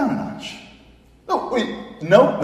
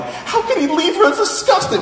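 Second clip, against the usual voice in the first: much higher and louder, laughing indignation; outraged at the movie; 7 dB louder than usual. Retold or imitated. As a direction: squeal with mock outrage at the screen. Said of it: "I'm like".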